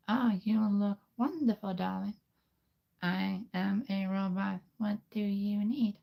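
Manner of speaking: glamorous robot assistant fussing over you; female robot voice. Shown as a demonstration. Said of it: "I've never done a female robot before".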